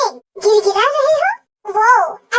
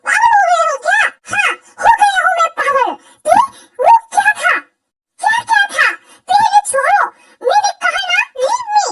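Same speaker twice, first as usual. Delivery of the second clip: angry shouting; 5 semitones higher than usual. Acted. Jealous angry quarrel.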